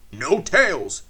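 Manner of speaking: put-on old engine silencing the coaches; firm. A narrator's reading. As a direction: stop the excuses firmly. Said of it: "said Skarloey firmly".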